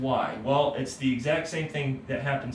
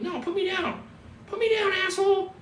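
much higher squeaky cat protesting; grumpy cat voice. Pretend. Teacher voices cats that hate being held, high and cranky. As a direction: voice an annoyed cat wanting down. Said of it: "They're like".

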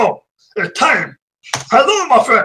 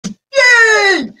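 happy cheer; 9 semitones higher than usual. Real, not acted. Streamer cheering at reaching new subscribers. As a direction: whoop with joy. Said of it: "So I'm happy!".

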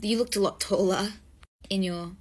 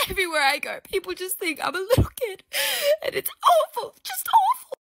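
high, loud hammed-up sobbing; mock wailing; 11 semitones higher, 5 dB louder than usual. Fake star wailing to the doorman about being taken for a kid. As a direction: wail it like a melodrama.

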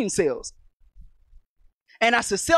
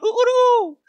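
high, loud cock-a-doodle ooh; mock rooster call, hooting high; rooster crow imitation; 14 semitones higher, 5 dB louder than usual. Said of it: "gifted with making the sound".

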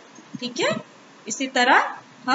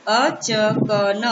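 very slow, achkan pulled apart as अ-च-क-न; split into syllables; 2 semitones lower, much slower than usual. Shown as a demonstration. Teacher sounding out achkan slowly for children. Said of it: "अब एक साथ मिला के पढ़ते हैं".

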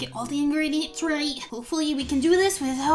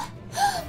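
shocked gasp; 3 dB quieter than usual. Acted. Shocked at hair.

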